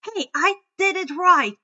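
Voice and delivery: loud, high, indignant cry; indignant protest. A narrator's reading. Druid protests to the others, loud and indignant. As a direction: protest that he got it right. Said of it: "the druid protested with a glare".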